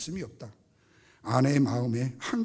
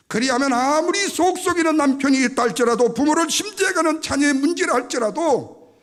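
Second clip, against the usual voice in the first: high, loud pulpit proclamation; full-force preaching; 10 semitones higher, 10 dB louder than usual. Real, not acted. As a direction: build the point at full preaching volume.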